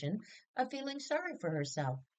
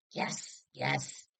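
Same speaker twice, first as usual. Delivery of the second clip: whispered, impatient yes yes; irritable whisper. Acted. Mother answering her son on the train, irritable whisper. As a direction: brush him off under her breath. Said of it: "She nodded irritably".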